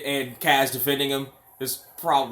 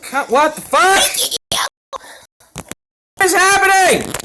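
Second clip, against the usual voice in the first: baffled shout; 11 dB louder than usual. Real, not acted. Baffled outburst at the game.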